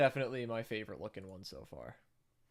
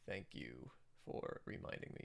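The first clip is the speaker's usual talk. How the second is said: low whispered thank you; whispered aside; 11 semitones lower, 10 dB quieter than usual. Real, not acted. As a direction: breathe it out under your voice, much lower.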